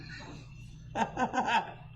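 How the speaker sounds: real cracked-up laugh, trailing off; helpless laughter. Real, not acted. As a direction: laugh breathily at your own story. Cracking up at his own story.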